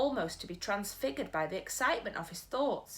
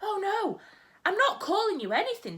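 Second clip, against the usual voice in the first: put-on higher, louder pleading child; cried; 12 semitones higher, 6 dB louder than usual. Sophie hurrying to calm the offended giant, crying out. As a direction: cry out to reassure him. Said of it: "Sophie cried".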